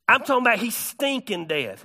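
normal talking voice

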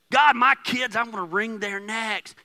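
loud exasperated mock prayer; frustrated parent's prayer. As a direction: pray it like a fed-up parent.

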